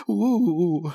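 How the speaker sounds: scared shivering ooh; a nervous little shudder; frightened moan. Acted. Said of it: "his teeth chattering".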